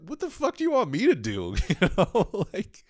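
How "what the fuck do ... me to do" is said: high-pitched comic exasperation; exasperated protest. Retold or imitated. Comic protesting girlfriend's vacuum request, exasperated. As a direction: squeal it in mock outrage.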